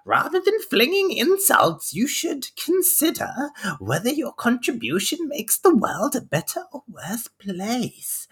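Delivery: very high-pitched, put-on imp; drawn-out, piping imp scolding. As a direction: lecture like a squeaky little imp. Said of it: "that's how an imp will speak".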